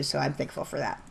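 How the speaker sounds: ordinary talk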